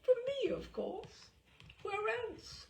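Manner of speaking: put-on high, jolly old lord; the old lord boasting cheerfully in a high voice; jovial boast. A narrator's reading.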